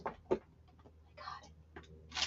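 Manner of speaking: slow, drawn-out whisper. Real, not acted. Whispering to herself as she finds it. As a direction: breathe it out slowly.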